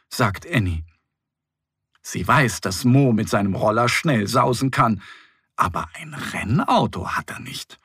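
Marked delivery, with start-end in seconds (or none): none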